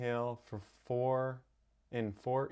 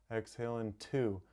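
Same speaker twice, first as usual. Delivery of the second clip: ordinary talk, nothing marked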